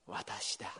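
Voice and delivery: softly, dropping to a breathy whisper; gentle whispered reassurance; hushed voice of Jesus. Acted. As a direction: say it low and close, like comfort.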